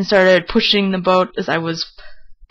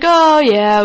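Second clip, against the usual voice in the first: high, louder cocky "oh yeah"; breezy overconfidence; 8 semitones higher, 5 dB louder than usual. Retold or imitated. Family assuring themselves they can take the boat, breezily confident. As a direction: brush it off as easy, voice lifted.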